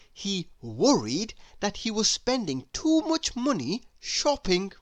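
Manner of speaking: ordinary delivery, nothing marked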